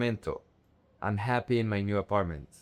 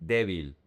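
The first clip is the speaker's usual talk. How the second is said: débil with the accent on DÉ; DÉ-bil, low and falling; stress on first syllable; 6 semitones lower than usual. Shown as a demonstration. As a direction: land firmly on the DÉ of débil. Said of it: "The emphasis is in D E".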